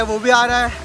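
ordinary talk

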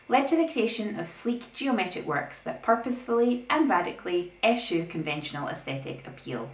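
ordinary talk, nothing marked

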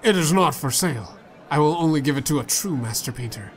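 ordinary delivery

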